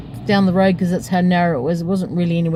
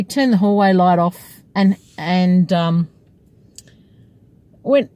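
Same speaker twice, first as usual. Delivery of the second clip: usual speaking voice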